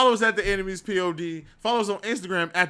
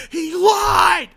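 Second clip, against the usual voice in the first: mock slogan; 13 semitones higher, 7 dB louder than usual. Announcing a joke hashtag.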